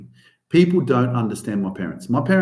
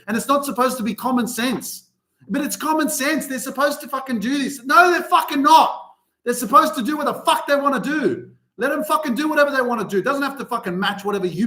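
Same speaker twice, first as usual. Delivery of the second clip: fired-up rant; 10 semitones higher than usual. Real, not acted. Ranting at judgers.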